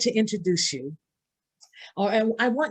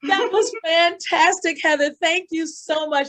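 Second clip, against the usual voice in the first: excited; 7 semitones higher, 3 dB louder, slower than usual. Real, not acted. Host thrilled after dance.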